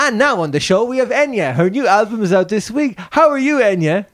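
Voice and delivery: high, excited chat show presenter; show host. Acted. Host introducing a singer on an imagined show, bright high presenter patter. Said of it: "so she's on".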